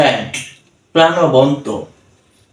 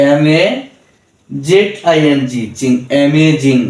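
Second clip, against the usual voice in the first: measured teacher's spell-out of amazing; syllable by syllable. Shown as a demonstration. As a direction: spell each part of amazing, pause, then say it whole. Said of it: "syllable ভেঙে ভেঙে".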